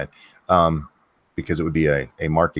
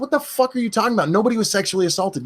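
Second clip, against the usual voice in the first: indignant outburst; 7 semitones higher, faster than usual. Real, not acted. Angry rant about the tweet.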